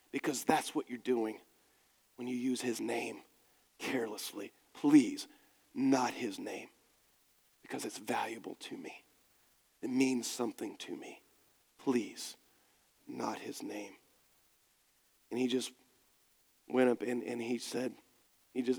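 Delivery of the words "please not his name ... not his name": gentle plea. Pretend.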